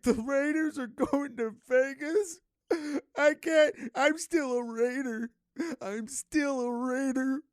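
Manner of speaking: high, slow, whiny mock sob of a Raiders fan; mock crying fan. Mocking distraught Raiders fans, high whiny mock sob. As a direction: whimper it like a heartbroken fan. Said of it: "I'm like".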